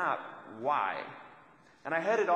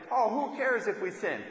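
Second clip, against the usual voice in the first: higher, giddy mock-carefree quote; mock excitement; 6 semitones higher, 3 dB louder than usual. Preacher mimicking careless early Christians, mock giddy. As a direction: toss it off like a giddy new believer. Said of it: "They're, they're so excited".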